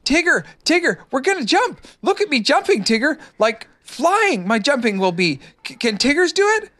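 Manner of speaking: very high, eager little voice; excited Roo calling to Tigger; wildly excited. A narrator's reading. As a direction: call out thrilled like a tiny kangaroo. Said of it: "wildly excited and cried out".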